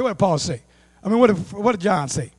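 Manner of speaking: normal talking voice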